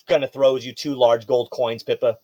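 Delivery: ordinary talk, nothing marked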